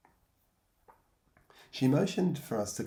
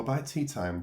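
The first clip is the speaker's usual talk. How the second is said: ordinary delivery, nothing marked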